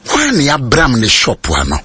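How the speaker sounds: normal talking voice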